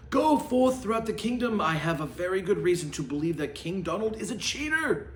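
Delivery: commanding proclamation. Acted. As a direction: rush out the command. Knight commands the heralds.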